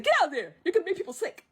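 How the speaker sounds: high, laughing telling-off; mock scolding. Mushu shooing something from the porridge, mock scolding.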